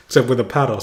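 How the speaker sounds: usual speaking voice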